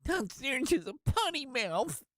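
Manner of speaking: high, prim self-scolding voice; tut-tut like a prim church lady; mock scolding. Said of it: "Sorry. I just got worked up".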